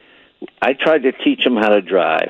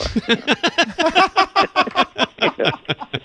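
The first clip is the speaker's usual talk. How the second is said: big high-pitched burst of laughter. Real, not acted. Laughing at his own joke.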